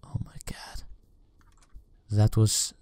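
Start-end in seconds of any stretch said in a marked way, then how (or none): 0.0-0.8 shaken, whispered under the breath; breathless whisper. Real, not acted. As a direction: whisper it in shock. After the scare, breath gone.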